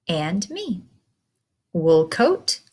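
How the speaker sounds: ordinary talk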